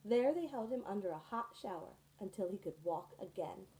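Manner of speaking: usual speaking voice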